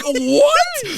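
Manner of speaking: very high-pitched squeal of disbelief; mock disbelief. Accused of making faces while tripping, mock disbelief. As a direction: squeak it out, acting totally baffled.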